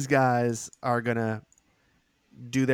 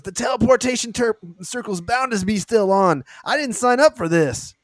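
fleeing soldier; 10 semitones higher, 6 dB louder than usual. Acted. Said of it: "He says".

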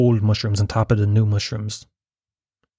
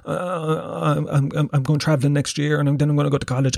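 higher-pitched flustered excuses, stumbling over words; retold defensive babble with stammered restarts; making excuses; 4 semitones higher than usual. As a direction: imitate yourself scrambling to justify yourself. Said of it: "I started making excuses".

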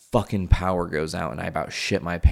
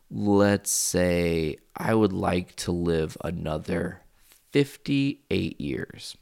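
drawn out; much slower than usual. Real, not acted. Weighing lifespan aloud.

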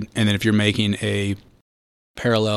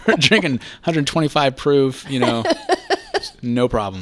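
ordinary delivery

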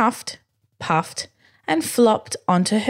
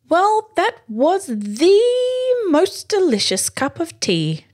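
much higher, slow, grand praise of tea; aristocratic voice; 11 semitones higher than usual. Acted. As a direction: savour each word, pinky up. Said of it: "Dad said".

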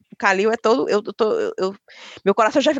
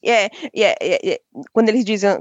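ordinary delivery

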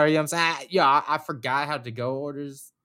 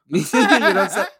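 laughing; 8 semitones higher, 7 dB louder than usual. Real, not acted. Laughing after punchline.

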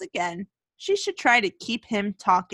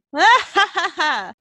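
high, hammed-up hysterical cackle; hysterical laughter; 9 semitones higher, 4 dB louder than usual. A narrator's reading. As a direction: burst out in mock-mad laughter. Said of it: "laughing hysterically for a long time".

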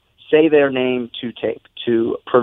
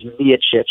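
"Miocic" as MEE-uh-chich; first-syllable stress. Shown as a demonstration. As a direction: put the emphasis on MEE in "Miocic". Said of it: "emphasis on, on the first syllable".

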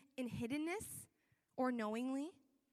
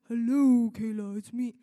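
very loud, drawn-out hail; mock booming God; 2 semitones lower, 14 dB louder than usual. God announces himself to a believer, comically booming. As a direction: boom it out like a voice from above.

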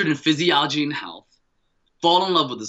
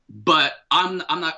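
usual speaking voice

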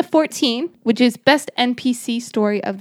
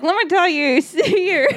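mock old prospector, pitched up; lightly hammed-up old-timer; old-timey guy; 7 semitones higher, slower than usual. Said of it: "this old-timey".

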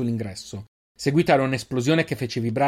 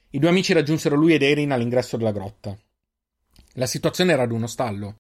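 usual speaking voice

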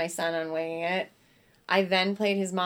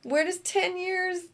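high-pitched imitation of bafflement; mock exasperation; 10 semitones higher than usual. Outraged reply continues.

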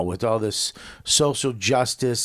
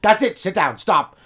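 loud, high string of urgent commands; retold panic, rapid repeats then short orders; alarmed parent; 4 semitones higher, 5 dB louder, faster than usual. Dad calling his son back from the roof edge, alarmed.

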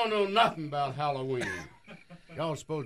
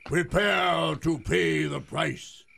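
ghostly apparition; much slower than usual. Acted. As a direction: boom it out like a ghost. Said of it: "he's overacting".

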